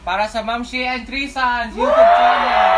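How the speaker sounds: loud festive toast; cheering shout. Real, not acted.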